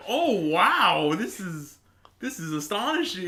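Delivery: high, swooping hammed-up awe; exaggerated wonder, loud on the wow; mock amazement. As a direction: gush as if witnessing a marvel. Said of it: "And they're like".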